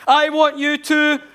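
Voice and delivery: loud, high, slow bellow; shouting the command far too loud as a bad example; overdone shout. Shown as a demonstration. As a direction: bellow it as the wrong way to embed. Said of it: "you don't go".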